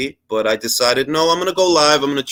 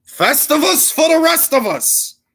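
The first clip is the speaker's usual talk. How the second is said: Seinfeld quote; 14 semitones higher than usual. Retold or imitated. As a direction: belt it like a TV catchphrase.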